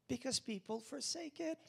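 ordinary talk

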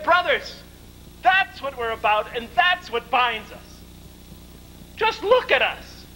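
high, slowing plea to the brothers; impassioned appeal. Retold or imitated. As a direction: draw it out, soaring on the last words. Leader's rallying plea to quarrelling brothers.

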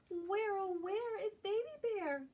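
high, carrying call for a lost cub; Mama Bear calling. Acted. Mama Bear searching for her missing cub, calling high. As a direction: call out high across the woods. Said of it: "calls Mama".

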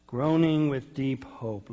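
drawn out, each word weighted; slow emphatic phrase. Real, not acted. Preacher stressing the phrase to his congregation, slowly. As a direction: lean on groaning, slowly.

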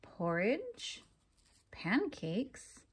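usual speaking voice

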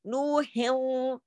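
"नूहिन" drawn out, ending in a nasal w; idgham into waw. Shown as a demonstration. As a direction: stretch "नूहिन" as "nuhiww", the n merged away. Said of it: "इसकी आवाज देखिए उसमें".

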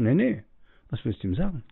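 ordinary talk

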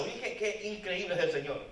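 ordinary talk, nothing marked

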